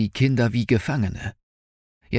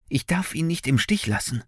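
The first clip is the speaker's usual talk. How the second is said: higher, urgent inner voice; distressed boy; 7 semitones higher than usual. A narrator's reading. Finn cannot abandon the old man, high and urgent. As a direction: raise the pitch with resolve.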